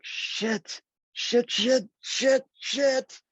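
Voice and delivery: high, rising panicked curses; panicked swearing. Retold or imitated. Stuck late in traffic, cursing in rising panic. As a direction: freak out at the traffic, pitch climbing.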